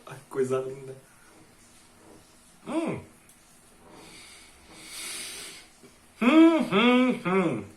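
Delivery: talking with mouth full while chewing. Real, not acted. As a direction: chew and talk through a mouthful. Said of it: "Falar de boca cheia é feio". (falando de boca cheia)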